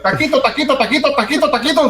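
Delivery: high, laughing Mexican Donkey. Retold or imitated. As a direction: chirp it like a dubbed cartoon donkey. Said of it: "whenever Donkey spoke, it was like".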